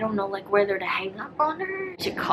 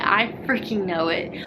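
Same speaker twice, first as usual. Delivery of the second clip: ordinary talk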